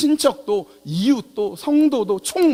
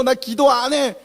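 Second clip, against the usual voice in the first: 높고 거세게 팽개치듯; 수도사가 토라져 선언하는 흉내; 홧김에 내뱉는 흉내; 6 semitones higher, 4 dB louder, slower than usual. Retold or imitated. 성경책 던지는 수도사 흉내. (버럭 화내는 시늉)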